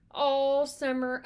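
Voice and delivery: loud, slow drawn-out mockery; sarcastic drawl. Twins mocking Percy's boasting, loud slow sarcasm. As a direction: drag it out to mock him.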